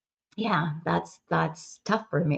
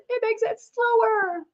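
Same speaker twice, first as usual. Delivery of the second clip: "It makes it slower" drawn out, slow and high. Shown as a demonstration. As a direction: stretch "slower" out slowly. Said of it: "And it makes it slower".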